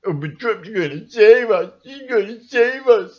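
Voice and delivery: high, loud sob-laugh whine; hammed-up whining believer; mock whining believer. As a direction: mimic them pleading, laughing. Mocking Trump supporters.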